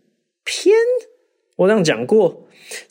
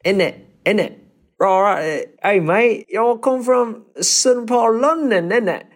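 high, hammed-up Cockney London voice; mock Cockney geezer, innit; playful Cockney impression; Cockney accent; 9 semitones higher, slower than usual. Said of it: "看你要Cockney".

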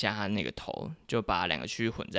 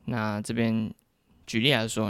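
normal talking voice

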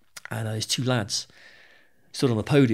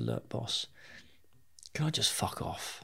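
hushed request; 10 dB quieter, slower than usual. Retold or imitated. Quietly asking boss to leave.

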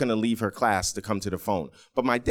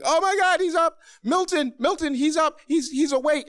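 very high, shrieky excited mom; hysterical, high-pitched relieved mother; frantic mother. Retold or imitated. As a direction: call out to your husband in a squealing fluster. Mother cries out as son wakes.

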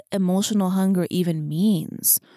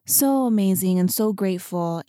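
normal talking voice

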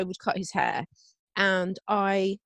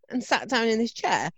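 normal talking voice

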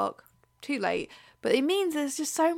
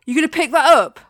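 loud, raised-pitch mock challenge; imitated shout; 5 semitones higher, 10 dB louder than usual. Imitating the man shouting at her about dog poo, loud challenge. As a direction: call it out like an accusing stranger. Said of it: "a guy shouted at me".

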